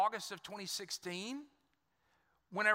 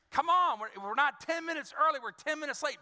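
raised, higher, exasperated push; frustrated urging; 6 semitones higher, 4 dB louder than usual. Retold or imitated. Husband urging his late wife, exasperated and raised. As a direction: hurry her along, fed up.

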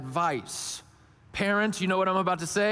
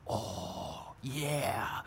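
low, soft drawn-out reply; awed little boy; 8 semitones lower, 10 dB quieter than usual. Retold or imitated. Son asked if Daddy can do it, hushed awe. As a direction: imitate a child quietly impressed by Daddy. Imitating son's reply to dad.

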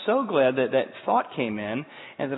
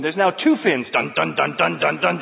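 ordinary talk, nothing marked

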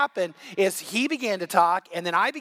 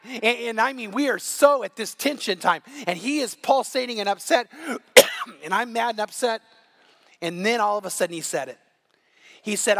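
usual speaking voice

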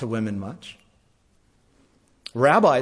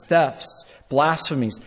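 usual speaking voice

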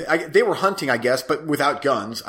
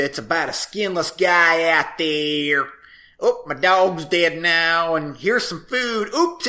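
bad Southern accent. Co-host mocking an actress's movie accent, bad Southern accent. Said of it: "she talks with this accent".

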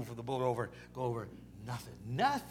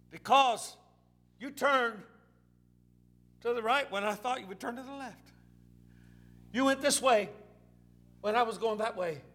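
raised, halting complaint to God; frustrated complaint; 6 semitones higher, 4 dB louder than usual. Acted. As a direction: outburst easing down, still pitched up. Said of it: "Peter was frustrated".

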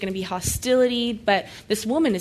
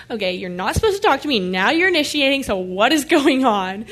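higher, louder mock indignation, slowing at the end; sassy; 4 semitones higher, 5 dB louder than usual. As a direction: act out a sassy, incredulous challenge. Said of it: "getting a little sassy with Jesus".

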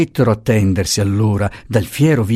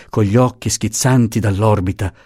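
usual speaking voice

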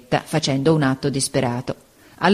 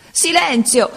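higher cry of silence, anger edged with tears; angry tearful shout; 10 semitones higher, slower than usual. A narrator's reading. Supplente esasperato grida alla classe, rabbia e pianto. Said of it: "gridava con voce di rabbia e di pianto".

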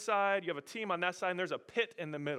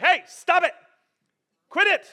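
loud, higher sharp parental command; parent scolding; 11 semitones higher, 14 dB louder than usual. Retold or imitated. Dad breaking up his daughters' fight, loud stern shout. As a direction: shout them down to stop.

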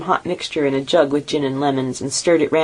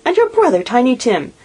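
much higher, fretting mother's complaint; fussing mother; 8 semitones higher than usual. A narrator's reading. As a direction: scold lightly about the late ones. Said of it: "said Missus Cratchit".

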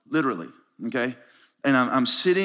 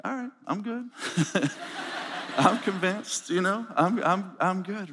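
higher, softer, laughing as you give in; laughing concession; 4 semitones higher, 4 dB quieter than usual. Retold or imitated. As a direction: chuckle through being easily won over. Laughingly concedes in his retold story.

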